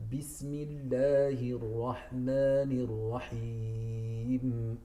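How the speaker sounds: "Rahmanir Rahim" slow with a tapped r; correct rolled r. Shown as a demonstration. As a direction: recite slowly, the tongue ticking the r. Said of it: "So we have".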